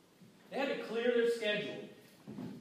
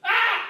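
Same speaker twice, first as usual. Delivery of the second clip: sudden loud high cry of alarm; panicked yelp; 13 semitones higher, 13 dB louder than usual. Retold or imitated. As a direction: yelp like the boat's going under. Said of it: "He goes like this".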